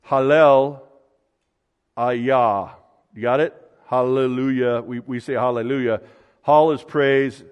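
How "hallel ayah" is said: "Hallel ... Ayah" in two separate parts with a long gap; word broken down. Shown as a demonstration. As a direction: sound out "Hallel", pause, then "Ayah". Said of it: "I'm gonna get this".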